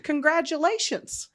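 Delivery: high, loud, drawn-out cheer; gushing congratulations. Retold or imitated. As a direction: celebrate it with playful excess. Retells texting the new governor.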